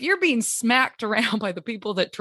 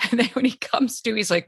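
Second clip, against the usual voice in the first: ordinary talk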